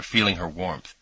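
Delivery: plain reading voice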